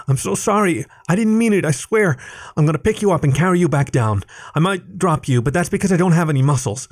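high, rushed, anxious apology; frantic apologetic cat. A narrator's reading. Alec kneeling by fallen Leif: high frantic apology. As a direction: babble your sorry.

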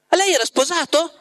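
high, incredulous question; astonished question. A narrator's reading. Ivan asks the Master about a wife, astonished. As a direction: ask it way up high, taken aback.

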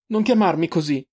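high, hurt outburst; shout. A narrator's reading. Sister rejecting the pet name, shouting. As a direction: snap it out high and upset. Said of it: "urlò la ragazza".

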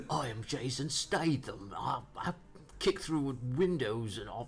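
slow, mock Cockney action-star voice; Jason Statham impression. Co-host mimicking Statham's Cockney accent, slow and hammed-up. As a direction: put on a tough London action hero, drawn out. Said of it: "I thought that's a Jason Statham accent".

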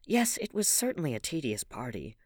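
usual speaking voice

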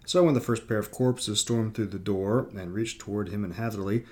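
usual speaking voice

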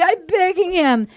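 high mock-tearful begging; hammed-up weepy plea; imitating sobbing pleas. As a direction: plead as if crying. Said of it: "And she's sobbing".

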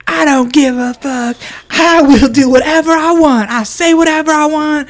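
high, loud mock defiance; brash, defiant. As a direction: imitate a brash woman declaring herself. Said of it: "she's very much the, like".

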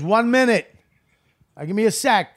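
calling out. Retold or imitated. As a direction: imitate a busy man putting people off. Retold man calling to groups.

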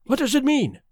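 high, startled questions; gasping alarm. A narrator's reading. Doctor asking the detective about the scream, gasping alarm. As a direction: gasp it out, pitched well up. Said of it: "I gasped".

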